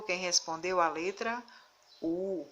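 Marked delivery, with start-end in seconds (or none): none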